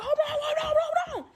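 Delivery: high, loud frantic yell to stop them; frantic shout. Retold or imitated. To three yelling women, a high frantic shout.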